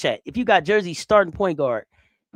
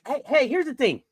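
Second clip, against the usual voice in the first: normal talking voice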